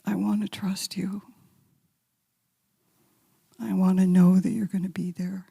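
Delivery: hushed, voiceless plea; a confession said barely aloud; whispered prayer. Retold or imitated. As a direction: breathe it to God in a whisper.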